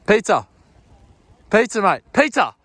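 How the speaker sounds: shouted up the slope; loud call to a mate. Real, not acted. Ski instructor pizza call.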